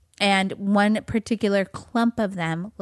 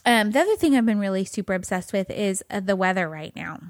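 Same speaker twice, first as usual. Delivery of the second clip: normal talking voice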